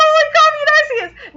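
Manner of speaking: high, loud outburst; astonished exclamation. Retold or imitated. Retelling own shocked reaction.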